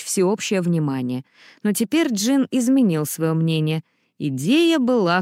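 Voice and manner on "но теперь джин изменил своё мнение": plain reading voice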